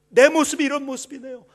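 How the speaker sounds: loud, high anguished cry to God; loud tearful prayer. Acted. Pastor reliving his tearful prayer, loud and high. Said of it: "눈물 흘리면서".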